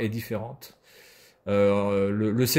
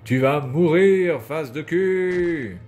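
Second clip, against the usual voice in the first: mock threat; 5 semitones higher, 6 dB louder, much slower than usual. Threatening a game character.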